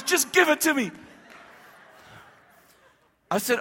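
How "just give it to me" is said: high-pitched, urgent demand; impatient outburst. Retold or imitated. Tony demanding his word from the pastor, impatient. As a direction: cut in, unable to wait any longer.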